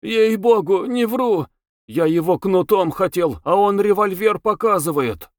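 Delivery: put-on whining young cabman, voice way up; high, pleading character voice; plaintive high voice. A narrator's reading. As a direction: complain in a high, halting voice. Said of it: "жалобно, высоким голосом говорил".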